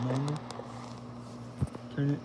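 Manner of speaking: ordinary talk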